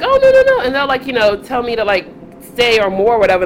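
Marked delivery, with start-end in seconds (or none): 0.0-0.6 high, quick mock protest; protesting men. Men in the van urging her to stay, mock protesting. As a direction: imitate people coaxing someone to stay. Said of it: "they were just like".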